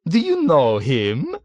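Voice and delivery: light up at the coincidence; delighted. A narrator's reading. Old man asks Nately, delighted. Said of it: "inquired the old man with delight".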